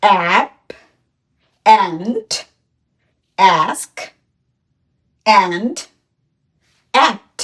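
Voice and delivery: অ্যাপ, and, ask, and, act, each said slowly and separately; teacher leading a word list; word list read aloud. Shown as a demonstration. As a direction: read out the list for the class to repeat. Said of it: "চলো আমরা সবগুলো বলি".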